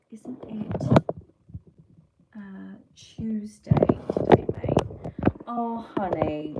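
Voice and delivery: slow, put-on knowing voice; mock innocent teasing of a viewer; sly teasing voice. Real, not acted. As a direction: drop a cheeky hint. Hinting at the C word joke.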